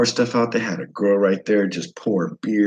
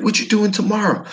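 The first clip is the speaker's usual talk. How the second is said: high, eager broken English; mock accent, pitched up, rising; imitated Vietnamese English; 14 semitones higher than usual. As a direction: coax the guest to eat. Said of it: "He's like".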